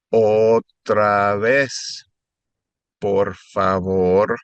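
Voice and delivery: very slow, drawn-out syllables. Shown as a demonstration. Tutor modeling the phrase slowly for students. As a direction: draw it out for learners to copy. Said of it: "normal and then a little slower".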